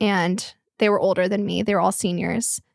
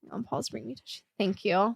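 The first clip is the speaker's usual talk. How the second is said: crying softly; 9 dB quieter than usual. Real, not acted. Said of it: "I didn't think I was gonna cry".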